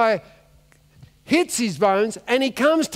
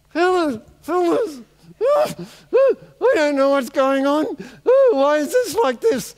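bewildered; 6 semitones higher than usual. Acted. Dead man confused and bewildered.

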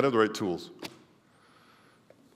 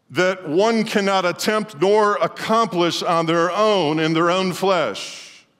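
slow, drawn-out preaching climax; solemn slow sermon build; 7 semitones higher, 3 dB louder, slower than usual. Acted. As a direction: stretch it out like a pulpit climax. Preacher slowing for emphasis.

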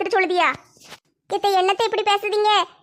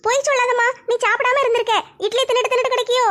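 put-on higher girlish voice with a laugh; teasing young daughter; 4 semitones higher than usual. Daughter teases her mother about not eating, girlish and laughing. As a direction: tease your mom lightly, laughing.

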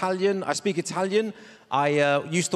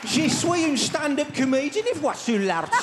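French in a higher Brummie accent; 7 semitones higher than usual. Shown as a demonstration. Comic speaks French with a Brummie accent. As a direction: Birmingham whine on the French. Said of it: "French with a Brummie accent".

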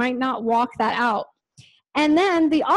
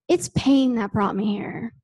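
usual speaking voice

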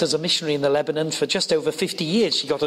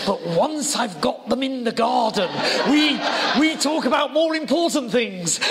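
higher, animated imitation of posh English; posh old lady confiding her scheme, pitched up; 6 semitones higher than usual. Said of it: "posh voice".

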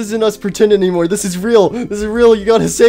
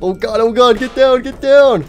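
panicked warning; 10 semitones higher, 5 dB louder than usual. Acted. Warning others during acted shooting.

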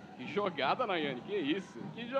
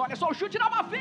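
excited; 3 semitones higher, 8 dB louder, faster than usual. Real, not acted. Shot called out.

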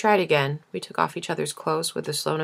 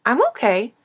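a hint of a high, louder little boy; lightly voiced child brushing it off; young boy's voice; 7 semitones higher, 6 dB louder than usual. Grandson answering his grandmother, lightly voiced child. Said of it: "That song in his voice".

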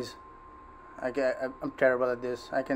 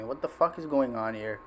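ordinary delivery

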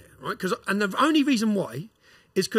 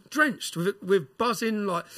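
ordinary delivery

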